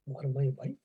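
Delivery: a shaken murmur as the truth lands; stunned realization. Acted. Scholar realizes the lamp-bearer is his wife, hushed shock. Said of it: "a great rude shock".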